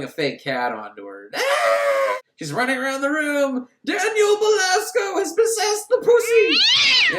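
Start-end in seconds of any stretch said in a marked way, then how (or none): 1.3-2.2 startled scream. Acted. Fake cat thrown onto her. 3.8-7.2 high, loud, slow melodramatic scream; a far-off panicked wail of accusation; hysterical shriek. Acted. Victim shrieks while attacked by cat.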